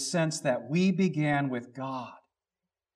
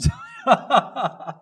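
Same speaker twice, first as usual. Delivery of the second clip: laugh; 8 semitones higher, 7 dB louder than usual. Real, not acted. Laughing at lawyer story.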